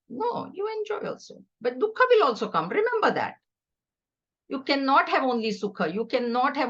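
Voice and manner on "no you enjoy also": playful repeat. Retold or imitated. Repeating Bhagwan's words playfully.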